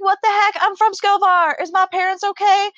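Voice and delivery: high-pitched mock dismay; retold alarm. Hearing her hometown is underwater, acted alarm. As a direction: blurt it out in replayed shock.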